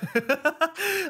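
sudden high burst of real laughter. Real, not acted. Streamer bursting out laughing at the game's absurd line. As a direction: crack up at the joke. Reacting to absurd game text.

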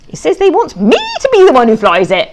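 usual speaking voice

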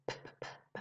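unvoiced p-p-p lip pops; whispered p puffs. Shown as a demonstration. Teacher showing P and B in the same place, breathy lip pops. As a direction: pop your lips silently on p. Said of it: "P e B no mesmo lugar".

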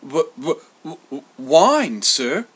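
nervous stutter on the first sound; stuttered, faltering answer; stammering. A narrator's reading. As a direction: stammer haltingly to a master. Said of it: "Glaucus stammered".

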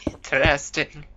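"Interesting." with the voice cracking mid-word; a real accidental crack on "Interesting.". Real, not acted. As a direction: let your voice break on "Interesting.". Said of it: "My voice cracked".

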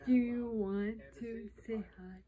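soft unvoiced whisper. Real, not acted. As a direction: whisper gently to a pet. Whispering to the pet baby.

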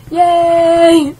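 loud, high cheer; cheering yay. Real, not acted. Gamer finds mud in the game, loud cheer. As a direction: celebrate the find.

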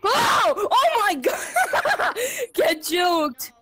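shrieking laugh while escaping; high giddy triumphant yell; being chased. Real, not acted.

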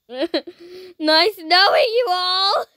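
slow, high, hammed-up tearful goodbye; mock farewell. Saying goodbye to fellow players, hammed-up tearful wail. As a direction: drag out a fake sob of farewell.

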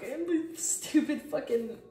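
loud, high and grossed out; disgusted. Real, not acted. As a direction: recoil in disgust. Disgusted at caterpillar drawing.

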